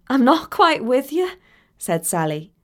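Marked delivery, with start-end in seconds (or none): 0.1-1.3 higher, mildly bewildered; voiced puzzlement; puzzled. A narrator's reading. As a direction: ask in confusion. Sally baffled by Talia's order.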